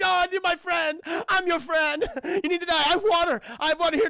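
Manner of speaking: high, loud, hammed-up pleading; acted desperate high holler; frantic pleading. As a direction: plead frantically, pitched right up. Acting Tuco frantically pleading with Blondie.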